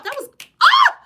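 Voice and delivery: high, loud joyful ah; delighted shriek. Real, not acted. Host overwhelmed by a viewer's reply, shrieking with joy. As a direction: let out a squeal of joy.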